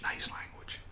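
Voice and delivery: sarcastic whisper; sneering aside whisper. Shown as a demonstration. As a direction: hiss it unvoiced and mocking. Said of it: "whispered repeat".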